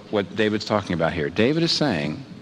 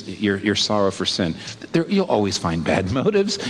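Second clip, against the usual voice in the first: normal talking voice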